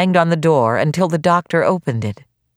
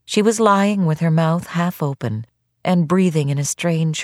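plain reading voice

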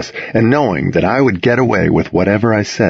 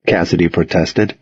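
ordinary delivery

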